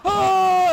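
high, loud panicked scream; long terrified shout; both screaming in terror. Retold or imitated. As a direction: yell like death is right there. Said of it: "he started screaming too".

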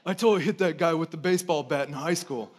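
a lightly voiced dim jock, bragging; lightly mock the old bully boasting; imagined bragging bully. Pastor imitating the bully bragging to his brother, a hint of dopey swagger. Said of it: "that's how he talks in my, in my mind".

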